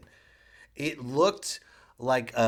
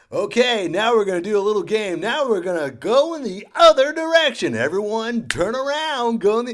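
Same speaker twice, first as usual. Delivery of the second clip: loud, high announcer barking orders; rink announcer; 8 semitones higher, 7 dB louder than usual. Retold or imitated. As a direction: boom instructions over the PA. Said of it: "on the speaker".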